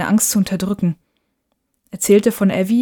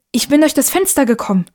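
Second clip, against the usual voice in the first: higher, falling statement, stress on DURCH; eager explanation; 6 semitones higher than usual. Acted. Boy explains his arrival to mother, eager.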